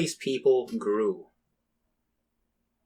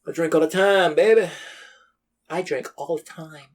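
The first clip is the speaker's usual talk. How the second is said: slurry, boozy woman, higher and louder; drunk woman voice; 5 semitones higher, 3 dB louder than usual. Pretend. To his friend, a lightly voiced drunk woman. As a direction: play a lush bragging about her drinking. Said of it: "Is that how you would sound as a woman?".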